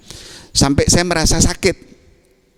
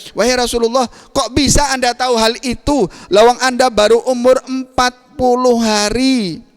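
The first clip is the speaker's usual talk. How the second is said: amazed uncle; 9 semitones higher than usual. Acted. Abbas marvels, quoted again.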